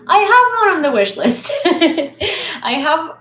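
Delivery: high, loud, excited call; excited outburst. Real, not acted. Streamer blurting out about the wish list, excited outburst.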